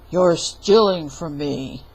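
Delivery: low, loud giant accusation; giant accusing. Acted. Giant catching Jack stealing, low and loud. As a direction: bellow the accusation.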